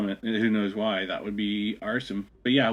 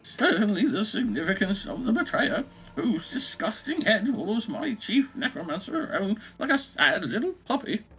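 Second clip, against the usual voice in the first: put-on high, slow old English lady voice; old English woman; 13 semitones higher than usual. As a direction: read it as a prim elderly Englishwoman. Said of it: "this should be like an old English woman".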